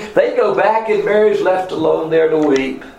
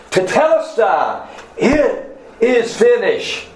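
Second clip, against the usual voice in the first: slow, higher-pitched cry of triumph; victory cry; 8 semitones higher, slower than usual. Retold or imitated. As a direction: draw it out high like a proclaimed victory. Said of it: "he cries out that victory cry".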